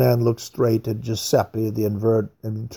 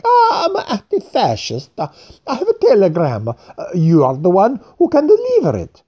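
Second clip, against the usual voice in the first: much higher, pleading, with a nervous laugh; apologetic fat man; 7 semitones higher, 4 dB louder than usual. A narrator's reading. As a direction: grovel a little to the major while pressing the telegram. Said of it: "Craxy was apologetic".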